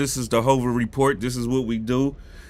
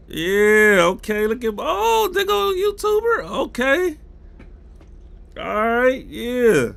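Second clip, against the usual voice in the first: excited; 9 semitones higher, 3 dB louder than usual. Real, not acted. Excited as new Kick viewers arrive.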